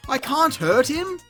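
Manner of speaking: ordinary delivery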